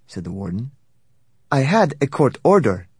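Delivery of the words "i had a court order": high, loud insistence; arguing back. A narrator's reading. Lawyer argues with warden.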